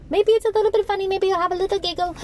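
high, sing-song teasing lilt; coaxing a giggle, sing-song; playful teasing. Real, not acted. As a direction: tease the listener in a high playful voice. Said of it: "playful hope to amuse".

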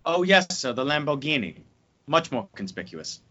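Michael Caine impression. Co-host asked for a line, low murmured Michael Caine impression. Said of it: "your Michael Caine impression".

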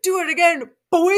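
loud, high mock challenge; hammed-up chest-out dare, breaking into laughter; puffed-up taunt. Retelling a man daring his rival to shove him, puffed-up taunt. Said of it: "puffs his chest out and is like".

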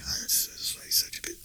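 whispered replay of the mutter; whispered insult played back on the tape. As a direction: hiss it under your breath. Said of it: "They hear you say".

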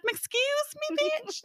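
very high, outraged mock Southern lady; an offended customer in a Southern accent snaps back at the worker; indignant Southern lady. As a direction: squeal it like an offended Southern woman. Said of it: "She had a very deep Southern accent".

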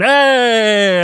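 loud, high "Hey!" in mock accent; drawn-out hey. Shown as a demonstration. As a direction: belt out a long, high hey. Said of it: "I like going".

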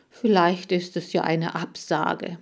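ordinary delivery, nothing marked

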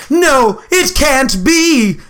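high, drawn-out mock despair; slow theatrical disbelief; melodramatic horror. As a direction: ham it up like stage tragedy. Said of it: "like a Macbeth scene".

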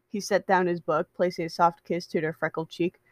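ordinary delivery

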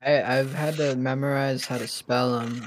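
usual speaking voice

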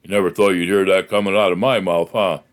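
chuckled aside in a deep, quick voice; low, wry Southern boss voice; Mo Brooks. Retold or imitated. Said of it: "He chuckled and went".

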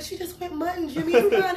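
high-pitched mom placating dad; lightly imitated, high reassurance; imitating mom. Voices mom calming dad in story.

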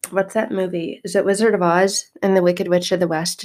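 ordinary talk

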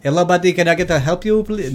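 attempted Indian accent. Shown as a demonstration. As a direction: have a clumsy go at an Indian accent. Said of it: "I can do Indian".

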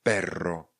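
PERRO with a long trilled RR; rolled double R. Shown as a demonstration. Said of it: "s dvěma R vyslovujeme perro".

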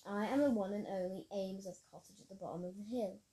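plain reading voice

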